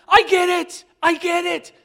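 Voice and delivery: high, loud, overjoyed repeat; excited shouting. Retold or imitated. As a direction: shout it at her in delight. Retelling his outburst to Donna.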